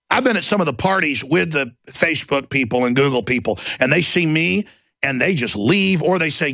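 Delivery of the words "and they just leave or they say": ordinary talk, nothing marked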